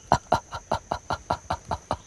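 soft unvoiced rhythmic pant grunts; chimp pant grunt. Shown as a demonstration. Jane demonstrating a chimp greeting, rhythmic pant grunts. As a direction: breathe out a chimp greeting in short huffs. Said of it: "doing the pant grunt of greeting".

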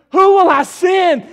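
slow, loud shout; booming call. Acted. God calling for a messenger, a booming slow shout.

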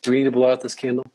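put-on sing-song it to a little kid; playful parent voice. Shown as a demonstration. Coach imitating a parent coaxing a child, playful and high. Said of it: "laughs, then says it again".

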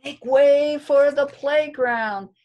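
loud trumpeting elephant call. Acted. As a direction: boom it out to clear the way. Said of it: "eight elephants cried".

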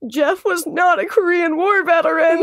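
retold tearful correction; imitating crying woman. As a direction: imitate an upset mourner, drawn out. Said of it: "she's, like, crying".

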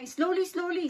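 high, loud imitation of the boss's order; imitated scolding. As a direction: raise pitch and volume sharply like a fussed employer. Retelling employer scolding over noisy pots.

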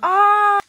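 excited gasp. Real, not acted. Fries surprise.

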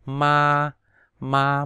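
ma held high and level; first tone "ma", high and even; Mandarin first tone. Shown as a demonstration. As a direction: sing "ma" on one high flat pitch. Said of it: "đọc ngang là ma".

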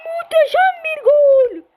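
high, loud goal scream; screaming football commentator. Real, not acted. Goal scored, commentator shout.

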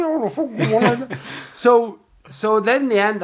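ordinary talk

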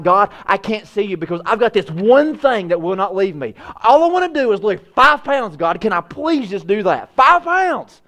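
high, strained plea to God; squeezed, high-pitched begging under a load; weighed-down straining prayer. Acted. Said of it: "we're like this. And we're like".

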